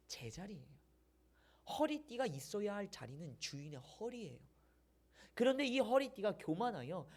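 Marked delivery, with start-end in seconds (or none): none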